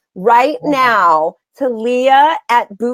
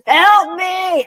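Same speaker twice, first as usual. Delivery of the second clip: louder body; 7 semitones higher than usual. Pretend. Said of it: "And then it gets louder".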